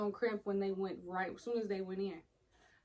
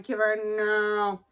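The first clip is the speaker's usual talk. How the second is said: slow, loud refusal; a loud cry of no; loud slow protest; 9 dB louder, much slower than usual. Retold or imitated. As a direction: drag it out, loud and pained.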